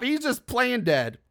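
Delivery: ordinary talk, nothing marked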